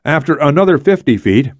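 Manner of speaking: ordinary delivery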